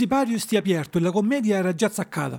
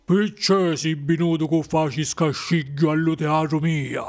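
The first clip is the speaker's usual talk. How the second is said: congested bogeyman voice; menacing puppet master, ogre-like; ogre with a cold; 2 semitones lower than usual. A narrator's reading. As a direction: growl the reproach like an ogre with a head cold. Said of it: "con una voce che pariva numao un furzionato".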